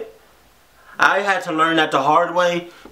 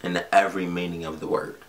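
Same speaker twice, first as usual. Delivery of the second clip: usual speaking voice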